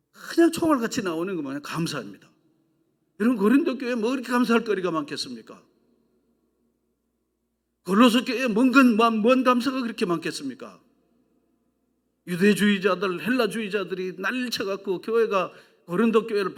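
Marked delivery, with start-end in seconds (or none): none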